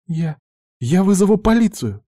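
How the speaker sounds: high, stammered start rising into alarm; acted high-pitched panicky girl; frightened threat. A narrator's reading. Cornered girl threatening her attackers with police, frightened.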